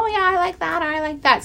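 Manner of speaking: husband approving other outfits, high and offhand; imitating husband. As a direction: toss off a casual yes. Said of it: "he would give me".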